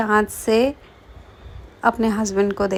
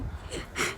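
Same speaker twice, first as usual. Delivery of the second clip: breathy laugh; 14 dB quieter than usual. Real, not acted. Can't stop laughing with husband.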